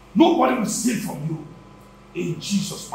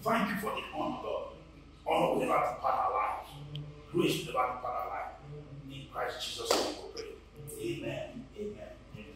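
closing prayer trailing off into a hushed whisper; hushed fading prayer; 4 semitones lower, 13 dB quieter than usual. Real, not acted. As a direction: let the prayer die away to a murmur. Prayer closing fades away.

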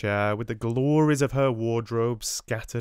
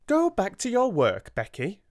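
put-on high, louder scolding of a servant girl; sharp order; 12 semitones higher, 4 dB louder than usual. A narrator's reading. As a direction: send her off sharply, pitched high. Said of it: "Miss Amelia had said".

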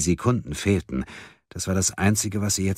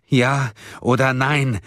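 slow, higher, insistent demand; calling out; 7 semitones higher, 4 dB louder, slower than usual. A narrator's reading. Man pressing friend for his vote, calling out. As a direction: demand an answer word by word. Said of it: "rief Erik".

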